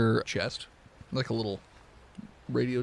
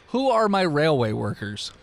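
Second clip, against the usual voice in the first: ordinary talk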